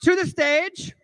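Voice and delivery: high, slow, hyped-up stage introduction; event announcer. Retold or imitated. Host introduces comedian to the crowd, mock announcer hype. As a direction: imitate an MC, dragging out the name. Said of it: "he's introducing me, and he goes".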